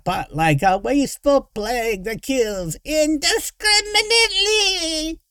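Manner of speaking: high, slow, raving villain screech; rant, climbing to a drawn-out shriek. A narrator's reading. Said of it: "Derek was screeching".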